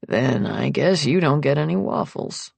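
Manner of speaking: low, grave man's voice; mock grave. A narrator's reading. Nick teasing her over the waffles, mock grave. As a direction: deliver a teasing threat with solemnity. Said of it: "he tells me gravely".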